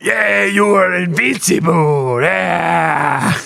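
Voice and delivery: loud, drawn-out mock Greek accent; hammed-up Greek shout, stretched out; deep Greek. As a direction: bellow it slowly in a bad Greek accent. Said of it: "the deep Greek".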